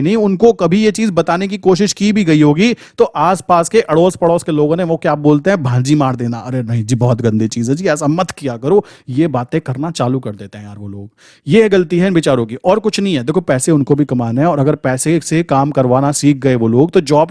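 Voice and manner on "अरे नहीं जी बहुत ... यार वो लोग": ordinary talk, nothing marked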